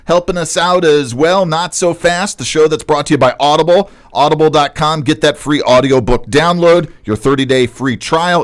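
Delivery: upbeat podcast opener, starting high and settling; show-host intro. Acted. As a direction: read the intro and sponsor plug like a radio host, drawing out the web address. Hosts the show intro and sponsor read.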